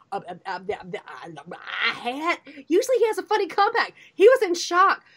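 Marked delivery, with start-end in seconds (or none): 0.1-2.4 soft, stunned stammer, much lower; a kid lost for words after his mom's outburst. Retold or imitated. Youngest son in back seat, stammering in shock. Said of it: "he's just like".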